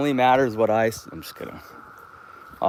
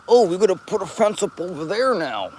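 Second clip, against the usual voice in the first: a lightly voiced worker, pitched up; mock workers' voice; 9 semitones higher than usual. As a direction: play the fussy worker. Imagined workers' voice.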